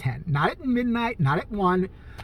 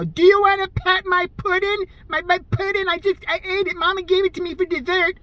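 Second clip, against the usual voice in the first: put-on dim-witted man-child voice; 11 semitones higher than usual. Mocking himself as a simpleton, high childlike whine. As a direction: ask about the pudding like an oblivious big kid. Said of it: "saying, you know".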